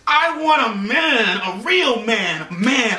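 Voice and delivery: high, drawn-out mock wail of frustration; frustrated demand. Kayla venting to her friend after the date, frustrated demand. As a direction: imitate her, stretching the words slowly.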